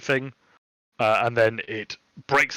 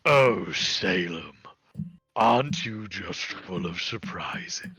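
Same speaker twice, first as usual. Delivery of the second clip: demonic patron. Acted. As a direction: purr it out slowly like a sly demon. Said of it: "demonic patron suddenly is speaking from the kitten, goes".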